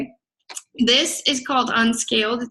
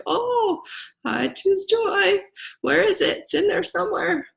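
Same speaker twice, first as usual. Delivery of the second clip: mock cheery voice; 8 semitones higher than usual. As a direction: parody a sugary positive-thinking type. Mocking someone told what to do.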